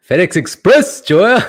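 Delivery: loud, high call-out. Real, not acted. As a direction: shout out the viewer's name. Calling out a viewer.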